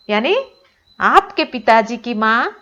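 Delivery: ordinary talk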